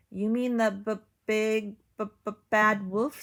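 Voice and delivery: nervous stutter on the b; frightened cow stuttering; stammering in fear. A narrator's reading. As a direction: stammer it out, scared. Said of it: "said Cow".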